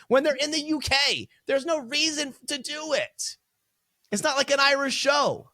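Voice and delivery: high-pitched exasperated protest. Real, not acted. Co-host ranting to the other about a misplaced Irish accent, exasperated.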